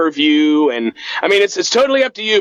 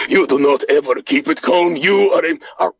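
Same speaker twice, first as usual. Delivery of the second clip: Boris voice; 2 semitones lower than usual. Acted. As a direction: grumble back in a thick Eastern European accent. Boris character answers back.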